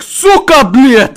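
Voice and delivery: high, very loud Russian curse; imitated Russian swearing. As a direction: yell it like an angry Russian impression. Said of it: "He's like".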